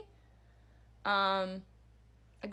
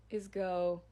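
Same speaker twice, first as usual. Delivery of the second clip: usual speaking voice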